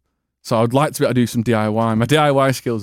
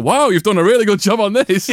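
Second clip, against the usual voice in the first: higher-pitched mock amazement; impressed teacher; 8 semitones higher, 4 dB louder than usual. Teacher praising a pupil's homework, impressed. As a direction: gush over the work like a teacher.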